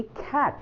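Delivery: CAT with a puffed, airy k at the start; aspirated k. Shown as a demonstration. Teacher demonstrating the American c in cat, aspirated k. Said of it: "सी में ख होता है American accent में".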